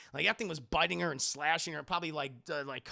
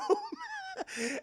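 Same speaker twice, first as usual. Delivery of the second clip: high laugh. Real, not acted.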